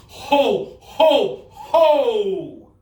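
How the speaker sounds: loud, drawn-out ho-ho-ho; Santa-style "ho" laugh. Shown as a demonstration. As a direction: boom out three playful ho's, last one long. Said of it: "everybody go like this and go".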